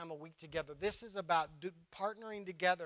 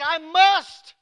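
high, loud ringing MUST; all-caps shout; 10 semitones higher, 14 dB louder than usual. Acted. Pastor showing how Jesus said it, shouting. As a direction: shout it as if written in capitals. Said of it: "it means you're shouting".

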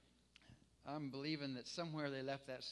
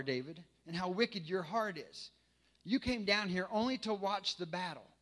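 angry; 4 semitones higher, 7 dB louder than usual. Acted. Eliab rebukes his younger brother David, loud and angry. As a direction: scold him hard, like a furious big brother. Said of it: "he burned with anger at him and asked".

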